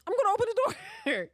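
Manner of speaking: put-on high, quick old-lady voice; mock grandma. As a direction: toss off the line like a stubborn grandma. Imitating grandma's sudden decision.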